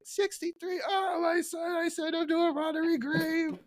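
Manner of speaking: put-on very high wailing old man turning to glee; grieve, then flip to delight; Grandpa, despair to joy. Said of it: "you go from despair to, to joy".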